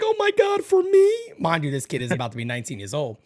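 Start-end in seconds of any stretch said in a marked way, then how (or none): 0.0-1.1 very high, loud squeal of delight; imitated daughter. Daughter given a Toothless backpack plushie, high mock squeal of delight. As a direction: squeal it like a thrilled teenage girl. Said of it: "She's like".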